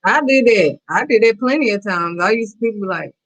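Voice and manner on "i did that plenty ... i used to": ordinary delivery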